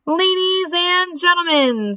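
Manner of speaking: high, slow, drawn-out announcement; pompous fairground announcer call; loudspeaker announcer. Acted. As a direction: hail the crowd grandly. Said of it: "said the loudspeaker".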